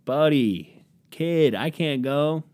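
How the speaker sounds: mildly pleading, stretched-out refusal; reluctant buddy. Acted. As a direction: slowly beg off from a friend's plan. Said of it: "And I'd be like".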